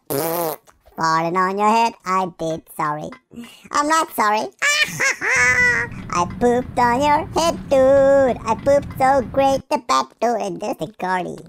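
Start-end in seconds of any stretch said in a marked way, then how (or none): 4.5-6.1 high giggle. Real, not acted. Big laugh at own joke.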